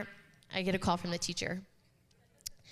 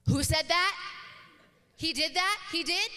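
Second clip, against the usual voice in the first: high, loud, indignant demand from the next room; outraged kid; 8 semitones higher, 4 dB louder than usual. Retold or imitated. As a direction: imitate a fired-up ten-year-old, louder and higher. Protective daughter reacting in story.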